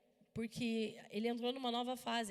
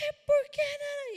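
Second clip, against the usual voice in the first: put-on teen blurting out; 9 dB louder, slower than usual. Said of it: "E ele".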